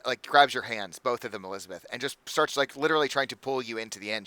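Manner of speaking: usual speaking voice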